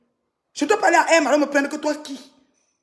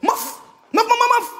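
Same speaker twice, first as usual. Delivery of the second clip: mocking shout; 12 semitones higher, 5 dB louder, slower than usual. Real, not acted. Mocking outburst at a rival.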